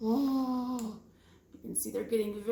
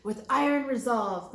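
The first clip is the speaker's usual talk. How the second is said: ordinary delivery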